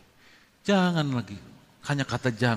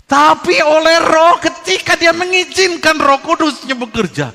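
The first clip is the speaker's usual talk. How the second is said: much higher, much louder, slow proclamation; preaching at full force; 13 semitones higher, 14 dB louder than usual. Acted. Preacher stirring the congregation about the Spirit, booming. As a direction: cry it out like a revival preacher.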